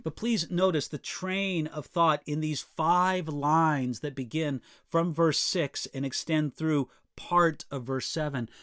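ordinary delivery

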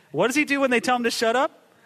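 usual speaking voice

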